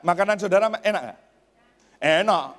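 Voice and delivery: normal talking voice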